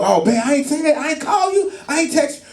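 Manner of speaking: hammed-up husband brushing it off; husband's lame excuse.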